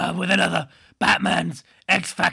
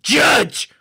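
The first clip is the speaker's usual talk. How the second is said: shouted word; 7 semitones higher, 4 dB louder than usual. Real, not acted. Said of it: "I shouted the word judge".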